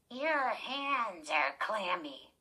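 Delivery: slow, lightly voiced dummy. Girl lightly voicing her dummy backstage, slow. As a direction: speak for the puppet, slowly. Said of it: "she made him say".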